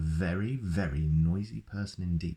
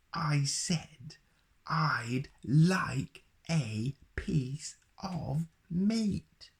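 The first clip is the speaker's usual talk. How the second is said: much higher, very slow drawn-out roar; roaring Mister Noisy; 10 semitones higher, much slower than usual. Acted. Mister Noisy roars at the baker who pretends not to hear, slow roar. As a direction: stretch every word into a roar. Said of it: "roared Mister Noisy".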